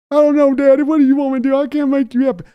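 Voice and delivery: high, loud put-on son's voice; Steven answering. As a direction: answer Daddy like a cowed grown son. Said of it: "yelling at Steven".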